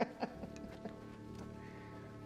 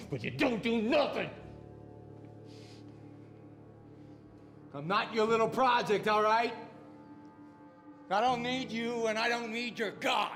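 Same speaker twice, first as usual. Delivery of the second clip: put-on raised, high-pitched drunken outburst; angry defiance; 4 semitones higher than usual. Drunk man rejecting the preacher's help, angry defiance. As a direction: throw it at them in furious rejection.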